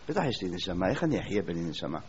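low, soft, matter-of-fact answer; low sure reply. Retold or imitated. The man assuring the questioner he has a soul, low and soft. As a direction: answer low as if it's obvious.

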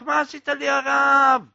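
high, loud pained yell; anguished scream. Acted. Penitent screams at the rabbi as lead burns, loud scream. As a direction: cry out in sudden burning pain. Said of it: "זה התחיל לצעוק".

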